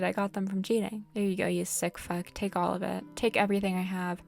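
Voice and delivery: normal talking voice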